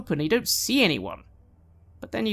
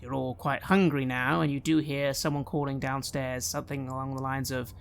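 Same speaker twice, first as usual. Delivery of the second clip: normal talking voice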